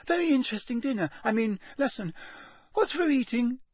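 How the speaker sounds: quick, very high gabble; unnaturally high. A narrator's reading. Shaken boy muddles his small talk, unnaturally high. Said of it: "gabbled in the same unnaturally high voice".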